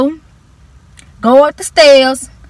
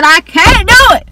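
begging the teacher, higher and louder; pleading cry, higher and louder; 8 semitones higher, 4 dB louder than usual. Retold or imitated. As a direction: raise the pitch and push it out.

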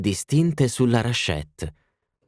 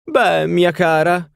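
put-on much higher, louder grand old lady; haughty duchess; 10 semitones higher, 5 dB louder, slower than usual. A narrator's reading. Duchess telling the party of her castles, lofty and high. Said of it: "obiettò la duchessa".

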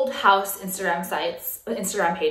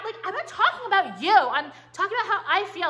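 exasperated defense; 8 semitones higher than usual. Real, not acted. Defending herself to viewers.

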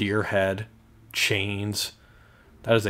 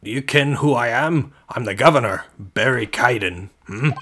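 emphatic, louder Scottish prison governor; attempted Scottish accent; 3 semitones higher, 5 dB louder than usual. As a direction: announce yourself in rough Scottish. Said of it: "I feel like he's Scottish".

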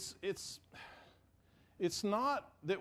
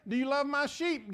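louder and higher, pressing the question; louder emphatic quote; 7 semitones higher, 4 dB louder than usual. Shown as a demonstration. Preacher repeats the misquote louder for emphasis. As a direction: throw the question out hard to the crowd. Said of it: "He doesn't say".

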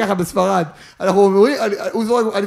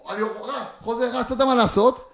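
ordinary talk

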